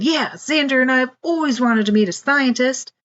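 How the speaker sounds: put-on a boy amazed by scientists; excited little boy voice.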